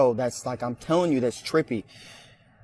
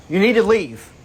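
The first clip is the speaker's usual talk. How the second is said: loud, raised command to the unseen presence; firm order; 6 semitones higher, 9 dB louder than usual. Real, not acted. Worker ordering the spirit to leave, a loud firm command. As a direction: tell the ghost to get out.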